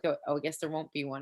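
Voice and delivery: quick, quiet throwaway line; off the cuff. Retold or imitated. Comedy coach echoing the comic's joke, off the cuff. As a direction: toss it off fast and low. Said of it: "very off the cuff".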